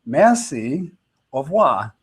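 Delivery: Merci, au revoir said lightly in French; a friendly French shopkeeper goodbye; French farewell. Shown as a demonstration. Wife describing French shopkeepers' goodbye, said in French. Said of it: "they always say".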